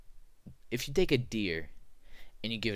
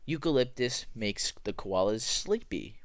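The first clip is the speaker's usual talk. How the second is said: ordinary talk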